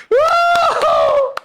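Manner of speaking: high loud whoop. Real, not acted. Speaker cheering his own joke, high whoop.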